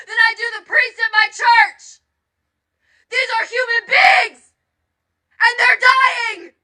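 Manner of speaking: furious and close to tears; real tearful anger. Real, not acted. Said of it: "I am angry".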